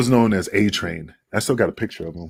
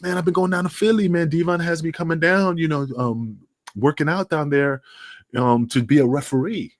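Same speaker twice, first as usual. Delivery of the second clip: higher, easygoing voice for the manager; retold Jabba; 9 semitones higher than usual. Manager telling the storyteller about Philly, higher and easygoing. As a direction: pitch up, laid-back, telling his news.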